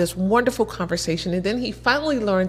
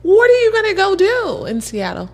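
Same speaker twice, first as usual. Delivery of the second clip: curious lady; 11 semitones higher, 4 dB louder than usual. Retold or imitated. Lady keeps questioning.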